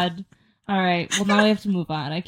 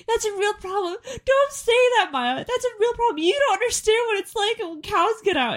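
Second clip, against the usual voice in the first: much higher mock rant; mock dad ranting; 12 semitones higher than usual. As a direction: ham up the dad's cow panic. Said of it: "those two got into a fight".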